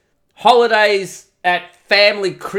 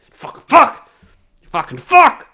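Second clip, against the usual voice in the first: frustrated swearing; 9 semitones higher, 4 dB louder, slower than usual. Acted.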